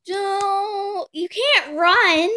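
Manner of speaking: high, loud, slow villain proclamation; dramatic game announcer. Pretend. Girl opening the fight to the death, hammed-up. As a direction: savour each word like a showdown host.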